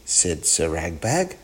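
usual speaking voice

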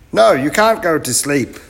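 loud, high commands; bossy professor. Acted. Professor orders the children to work, loud and high. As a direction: chivvy the crew in a high, loud voice.

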